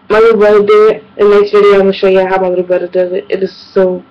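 ordinary talk, nothing marked

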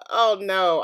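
usual speaking voice

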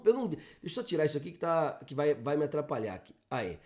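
ordinary talk, nothing marked

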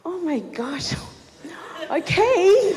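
high, laughing oh my gosh; giggly surprise. Retold or imitated. Narrator relives finding her friend got her food, laughing. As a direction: giggle through the delight.

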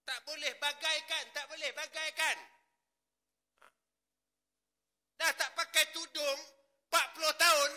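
high, loud, impassioned sermon; preacher crying out against a lax flock; preaching at full force. Acted. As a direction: scold the congregation with fervour.

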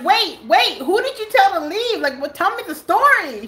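high, pressing questions; urgent questioning. Retold or imitated. Narrator stopping her sister, high urgent questioning. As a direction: chase her with eager questions.

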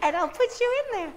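high, sing-song, overexcited voice to the dog; excited baby talk. Shown as a demonstration. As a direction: gush at the dog like it won a prize. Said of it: "But you say it like that".

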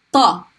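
ta, tongue pushing upward, heavy; emphatic heavy ta. Shown as a demonstration. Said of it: "heavy T sound".

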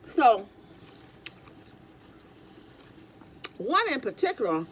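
talking between bites; chewing while talking. Real, not acted. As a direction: mouth partly full. Seen 1: Bites taco at -3; chewing, wiping mouth until ~4.4, then talks while cutting.

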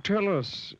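high, teasing pig voice; straight-faced pig. A narrator's reading. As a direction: hold back the laugh while asking. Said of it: "trying to keep a straight face".

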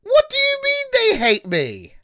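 very high, slow, drawn-out squeal; mock wail. Streamer told viewers hate him, high mock-hurt wail. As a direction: whine it way up high, stretching the words.

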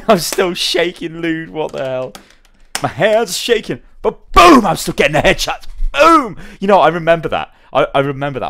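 high, loud, laughing recollection; giddy excitement. Real, not acted. Streamer recalls the crazy clip, giddy and laughing. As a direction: shout it like you're still buzzing.

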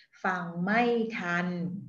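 "Fang mai tan." spoken very slowly, each tone marked; slow tone demo. Shown as a demonstration. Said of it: "to pronounce each word for you". (Fang mai tan said slowly for the learner)

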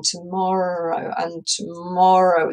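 slow, long vowels on tomorrow; dragging. Shown as a demonstration. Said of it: "This one kind of drags on".

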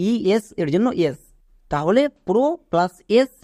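ordinary delivery

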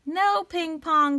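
high, loud, slow cry of alarm. Acted. Animals crying out as the pig leaps, loud and high. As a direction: call out to stop him. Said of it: "cried the animals".

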